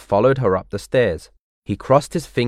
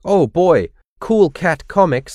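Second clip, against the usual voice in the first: higher-pitched storybook delight; excited; 6 semitones higher than usual. A narrator's reading. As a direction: react with eager delight, pitched up. Arthur thrilled by the comics.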